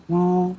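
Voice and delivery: উ held high and level; first tone. Shown as a demonstration. Teacher repeating the tone drill on another word, level high উ. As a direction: keep উ on one high, straight pitch. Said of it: "এই নিচের ক্ষেত্রেও same হচ্ছে".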